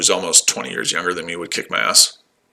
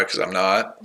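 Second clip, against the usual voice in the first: normal talking voice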